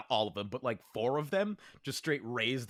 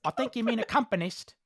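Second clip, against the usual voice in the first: piped-up correction; 8 semitones higher than usual. Acted. Guard correcting the yam thief's word, higher and smug. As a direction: pipe up to correct him, pleased with yourself. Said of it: "You hear one of them pipe up like".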